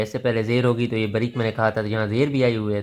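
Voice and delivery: ordinary talk, nothing marked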